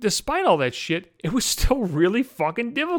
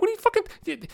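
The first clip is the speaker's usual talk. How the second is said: angry outburst. Real, not acted. Furious at the arguing patient.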